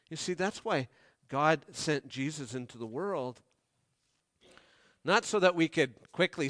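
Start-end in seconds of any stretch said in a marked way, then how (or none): none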